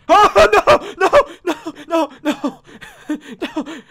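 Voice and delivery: startled high scream of no; real scream. Real, not acted. Player watching someone get killed in game, screaming. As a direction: scream it out, breaking into laughter. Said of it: "I don't know why I screamed".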